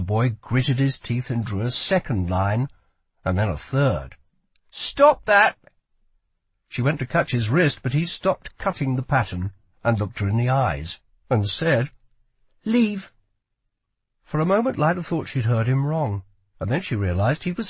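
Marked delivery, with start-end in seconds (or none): 4.7-5.6 high, loud order to stop; urgent command. A narrator's reading. Lila going for the boy's wrist, urgent command. 12.6-13.1 high-pitched one-word command; boy guard's order. A narrator's reading. Boy switches to English for intruder, urgent order.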